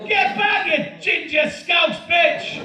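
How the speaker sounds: high-pitched yell after a runaway dog; shouting. Acted. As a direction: shout it out, stretching the insult. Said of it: "I'm shouting".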